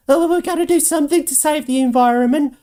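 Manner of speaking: high, quick mocking whine; mocking politician. Vlogger mocks politicians saving the environment, high quick mock whine. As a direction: sneer it like a preachy do-gooder.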